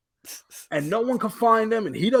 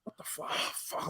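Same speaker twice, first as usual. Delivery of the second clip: stunned breathy whisper, much softer; whispered disbelief; 16 dB quieter than usual. Retold or imitated. Left on a cliffhanger, stunned whispered disbelief. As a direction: barely voice it, gobsmacked. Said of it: "sitting there going".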